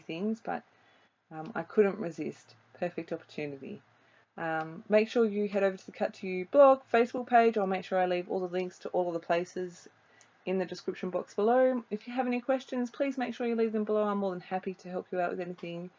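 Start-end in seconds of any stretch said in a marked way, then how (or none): none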